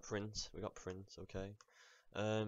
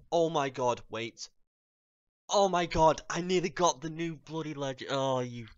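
loud, high excited yelling; excited shout; 6 semitones higher, 12 dB louder than usual. Real, not acted. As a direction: shriek with excitement at the near miss. Nearly unboxes new legend.

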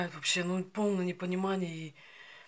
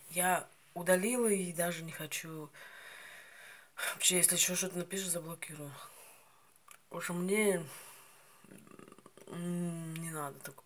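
speaking between bites; talking while chewing. Real, not acted. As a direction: mouth full of berries. Seen 4: Bites currants off stem at 2.5 and 8.1, chews between.

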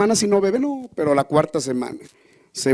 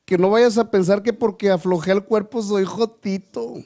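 borrachito; 5 semitones higher than usual. Acted.

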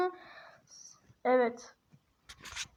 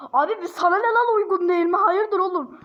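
higher and louder, challenging; mock indignant scolding; 4 semitones higher, 5 dB louder than usual. Real, not acted. Scolding the chatbot for its reply, mock indignant. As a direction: tell the bot off, half joking.

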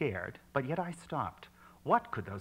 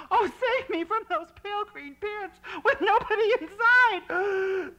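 very high, loud terrified wail; crying for help; 5 dB louder than usual. Acted. Narrator caught face to face with the pants, wailing for rescue. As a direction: wail for rescue at the top of your voice. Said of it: "I cried".

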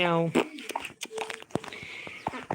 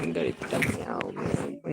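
deep mock meow; 13 semitones lower, 8 dB quieter, slower than usual. Playful meow at game pet.

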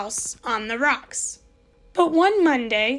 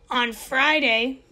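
ordinary delivery

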